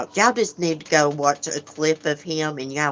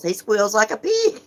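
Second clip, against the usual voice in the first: high, louder jab rising on the last word; mock pig squeal; 6 semitones higher, 4 dB louder than usual. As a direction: squeal it up in mockery. Mimicking a man's squealing talk.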